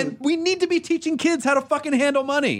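high, emphatic outburst; impassioned rant. Real, not acted. Host ranting about schools not teaching money, impassioned. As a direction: ride the exasperation high through every word.

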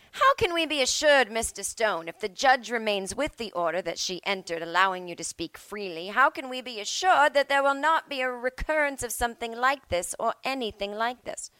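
put-on judge demanding assurances; mock judge. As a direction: press him hard for a guarantee.

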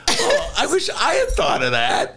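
high-pitched comic wail of admiration; mock envy. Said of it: "because it's like".

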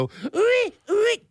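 high squeaky bird call attempt; failed bird call. Shown as a demonstration. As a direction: strain for the bird whoop. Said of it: "That's as best as I can do".

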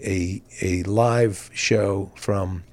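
usual speaking voice

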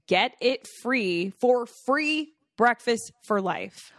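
slow, loud, every word spelled out; slow emphatic ad read. Acted. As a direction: read the code out slowly and loud. Ad read, code spelled out slowly.

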